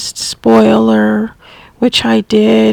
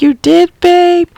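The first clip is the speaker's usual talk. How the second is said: delighted surprise; 8 semitones higher than usual. Retold or imitated. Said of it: "And she was like".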